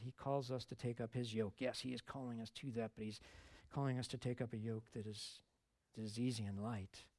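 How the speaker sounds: ordinary talk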